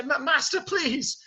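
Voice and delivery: high stammered plea; stutter, then plead; Lumiere, working up courage. Acted. Servant daring to stop Beast, timid urgent plea. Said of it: "gets up the courage to say".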